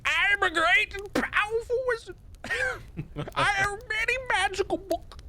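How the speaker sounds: very high, thin wizard squeak; piercing squeaky old kobold wizard; cranky old wizard. Pretend. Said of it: "I give him this voice".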